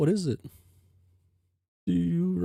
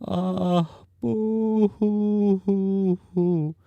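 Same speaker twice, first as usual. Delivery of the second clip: mock ghost sobbing; 3 semitones higher, 6 dB louder, much slower than usual. Ghost Jade wails again.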